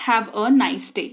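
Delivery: very slow, spelled-out English for practice; slow model sentence. Shown as a demonstration. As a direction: lay out every word slowly. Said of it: "teacher models the English line". (धीमी गति में अभ्यास वाक्य)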